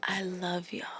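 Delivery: whispered, airy declaration of love; soft mock-zen whisper; hushed impersonation. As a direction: whisper it like a serene star. Impersonating a soft-spoken singer.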